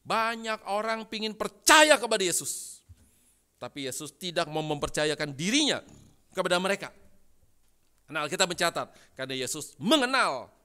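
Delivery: very loud, high, emphatic pulpit delivery; full-force preaching. Real, not acted. Preacher explaining the verse to congregation, at full force. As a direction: drive the point home to the whole hall.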